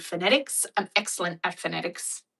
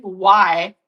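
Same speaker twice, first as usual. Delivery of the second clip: defiant why; 9 dB louder than usual. Retold or imitated. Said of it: "I was like".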